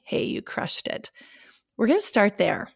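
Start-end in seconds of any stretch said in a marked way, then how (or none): 0.0-1.1 soft whispered praise. Pretend. Future self whispering you crushed it. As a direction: breathe it like a proud secret.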